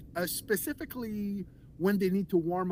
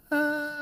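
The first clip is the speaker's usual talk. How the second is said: creaky fry with whispered air; vocal fry demo; 8 semitones higher, 5 dB louder than usual. Shown as a demonstration. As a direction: demonstrate the fry-whisper mix. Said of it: "mix a whisper, kind of".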